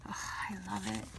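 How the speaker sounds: soft, breathy whisper of delight. Real, not acted. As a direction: whisper it as if to yourself. Fond whisper over beloved card.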